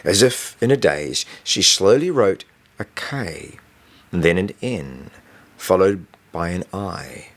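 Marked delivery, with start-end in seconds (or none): none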